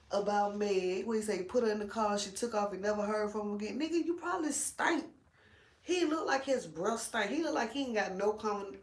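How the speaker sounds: usual speaking voice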